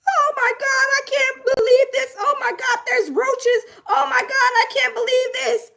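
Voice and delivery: high, whiny, hammed-up sobbing kid; mock crybaby. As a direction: wail it like a spoiled baby who's seen roaches. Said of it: "crying like a little baby".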